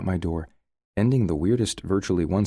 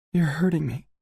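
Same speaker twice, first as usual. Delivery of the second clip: crying out; 11 semitones higher than usual. A narrator's reading. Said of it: "she cries".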